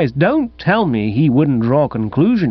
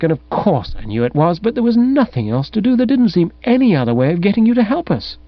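put-on lightly raised, coaxing girl's voice; young woman coaxing Bertie, pitched up and pleading; 7 semitones higher than usual. A narrator's reading. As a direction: wheedle him into helping. Female character voice pleading.

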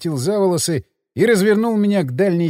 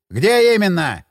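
openly distrustful; 4 semitones higher, 4 dB louder than usual. A narrator's reading. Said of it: "с нескрываемым недоверием".

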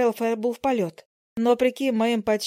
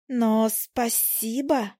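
slow coy thanks; much slower than usual. Acted. Flattered fly coyly thanks.

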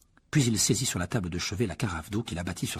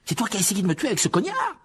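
much higher and louder; outraged accusation; 12 semitones higher, 4 dB louder than usual. Acted. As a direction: accuse him hotly. Harry confronts Dobby angrily.

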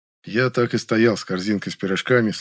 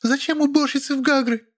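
high female voice full of disdain; disgusted retort. A narrator's reading. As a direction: sneer it in falsetto. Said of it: "скривилась Маша". (презрительно, высоким голосом)

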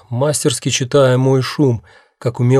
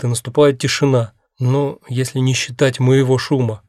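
ordinary delivery

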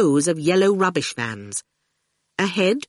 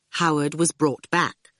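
usual speaking voice

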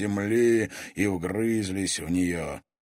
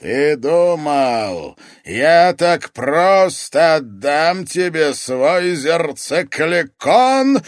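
slow, booming-loud, raised voice; terrible oracle voice, very loud and drawn out; thundering oracle; 8 semitones higher, 11 dB louder, much slower than usual. A narrator's reading. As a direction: thunder each word out slowly. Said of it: "прогремел вдруг в саду ужасный голос оракула".

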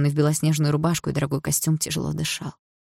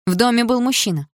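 higher, loud defiant mocking echo; defiant retort; 7 semitones higher, 6 dB louder than usual. A narrator's reading. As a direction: throw his words back at him. Вдруг сказала я.

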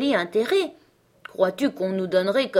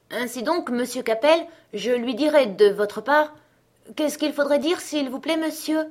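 higher, rising mock-innocent question; sly question; 6 semitones higher than usual. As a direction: ask with feigned innocence. Servant slyly asks master.

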